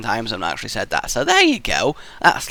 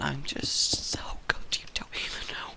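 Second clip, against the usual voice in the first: whispered mock boast; 2 semitones higher, 13 dB quieter than usual. Muttered mock boast to himself.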